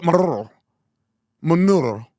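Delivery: Maduro with a rolled Spanish R, mocked; mocking Spanish accent. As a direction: imitate a newscaster slipping into a Spanish accent. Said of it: "he go".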